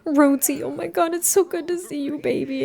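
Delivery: high-pitched, gushing mom voice; doting mom greeting. Acted. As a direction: act out a mother cooing at her returning son. Voices mom greeting her son.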